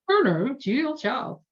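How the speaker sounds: high, lilting Julia Child imitation, badly done; a clumsy stab at Julia Child's voice; Julia Child impression. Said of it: "Really horrible at doing accents".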